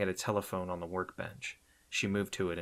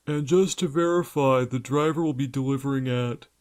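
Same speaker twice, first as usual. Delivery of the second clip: phony zombie voice; 7 semitones higher, 9 dB louder than usual. A narrator's reading. Robin keeps up fake voice.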